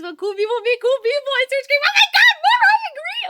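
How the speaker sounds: high, loud excited yelling; hyped shout. Real, not acted. Over-caffeinated streamer shouts excitedly at chat. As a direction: let the excitement burst out.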